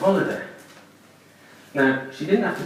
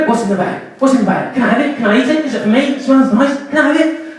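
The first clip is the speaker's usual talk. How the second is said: high, loud, pestering dog voice; eager dog; 10 semitones higher, 8 dB louder than usual. Pretend. Dog sniffing the shopping bag, high and pleading. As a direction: beg for the shopping like a greedy pup.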